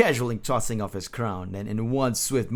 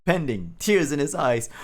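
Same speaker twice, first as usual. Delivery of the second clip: usual speaking voice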